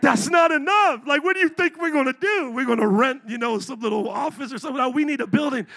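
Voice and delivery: loud, high mock protest; indignant retort. Spanish church members rejecting the offer, loud mock indignation. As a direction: raise the voice in exasperated imitation.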